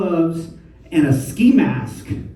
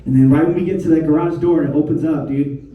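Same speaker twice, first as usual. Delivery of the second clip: ordinary talk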